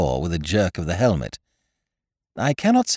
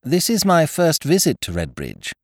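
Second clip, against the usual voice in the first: chatty; 9 semitones higher, 3 dB louder than usual. A narrator's reading. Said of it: "I said chattily".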